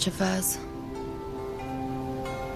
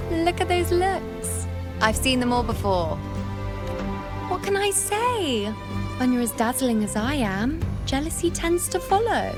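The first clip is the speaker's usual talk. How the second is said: preening, high-pitched self-admiration; haughty boasting; 4 semitones higher than usual. Acted. Dazzling priestess gloating over jealous looks, haughty boasting. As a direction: revel in the envy with showy, swooping high pitch. Boasts before envious gladiators.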